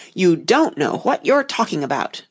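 plain reading voice

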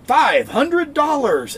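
high, slow hammed-up announcer; mock grand announcer; game show announcer. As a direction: oversell a tiny prize like an old announcer. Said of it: "it's like".

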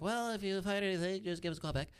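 high, put-on official phone voice; voiced report taker. Report taker to theft victim, high imitated phone voice.

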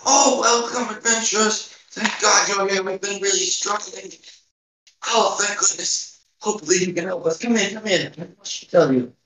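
lightly voiced tiny old lady; very old woman. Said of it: "She goes".

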